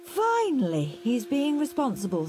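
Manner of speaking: high, relieved mother, lightly voiced; a mum who can hardly believe it; Mum's voice. A narrator's reading. Mum relieved about Henry to Dad, in a lightly voiced high tone. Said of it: "said Mum".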